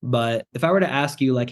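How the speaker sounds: ordinary talk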